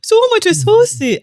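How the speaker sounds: high, slow mock Dutch accent; mock Dutch accent, high and drawn out. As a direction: do a hammed-up Dutch settler. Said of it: "when the Dutch arrived".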